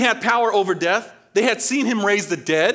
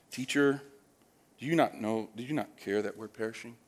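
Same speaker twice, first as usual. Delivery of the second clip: mock sleepy mumble; 7 semitones lower, 10 dB quieter than usual. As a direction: say it half-asleep, barely bothered. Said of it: "I read this and sometimes I'm, like, here and, like".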